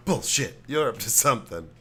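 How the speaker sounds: loud gruff accusation; dad catching the kids, loud retort; imitating dad's retort. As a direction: call their bluff, loud. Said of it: "his responses".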